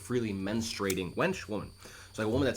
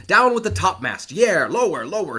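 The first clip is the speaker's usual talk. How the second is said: much louder and higher, barked commands; bosun shouting orders; 7 semitones higher, 9 dB louder than usual. Acted. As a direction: bellow orders over the storm. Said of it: "he's calling out more things to do".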